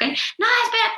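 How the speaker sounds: "no, espera" agudo e insistente, como niño; voz de niño que no la deja ir, mucho más aguda. Retold or imitated. (el niño le pide a mamá que no se vaya, agudo e insistente)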